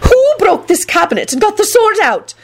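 very high, shrill indignation; shriek. A narrator's reading. McGonagall demanding who broke the cabinet, shrieking. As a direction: shriek the questions in outrage. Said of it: "she shrieked".

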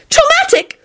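very high panicked cries; very high frightened screams; screamed in panic. Acted. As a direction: shriek it, fearing for Timothy. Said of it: "Solange jumped up and screamed".

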